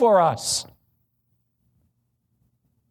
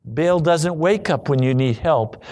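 normal talking voice

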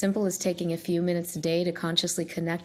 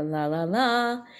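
vintage echo chamber; slower than usual. Shown as a demonstration. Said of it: "like you're in an echo chamber".

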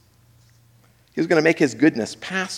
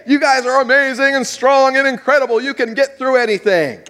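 higher, louder hype voice; mock flattery; 9 semitones higher, 3 dB louder than usual. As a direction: hype them up, tongue in cheek. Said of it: "He doesn't say".

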